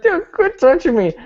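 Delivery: high-pitched comic complaint; mock irritated whine. Inmate to the guy who keeps touching him, mock whine.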